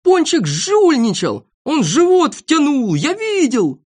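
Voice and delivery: high, loud, drawn-out accusation; slow, indignant high cries; accusing shout. Acted. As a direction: shout him out as a cheat. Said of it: "закричал тут Сиропчик".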